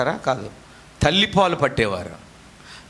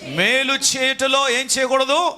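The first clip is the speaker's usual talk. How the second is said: quizzing call; 9 semitones higher, slower than usual. Real, not acted. Preacher quizzing congregation.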